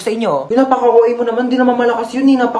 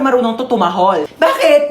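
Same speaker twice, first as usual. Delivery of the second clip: ordinary delivery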